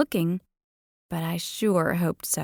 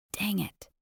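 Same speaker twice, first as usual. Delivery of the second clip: muttered; 9 dB quieter than usual. A narrator's reading. Muttered inner curse.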